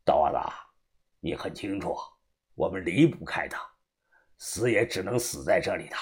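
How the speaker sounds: low, quiet, icy warning; cold. Acted. Old boss coldly telling the follower nobody can leave, low. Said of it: "同样一脸的冷漠".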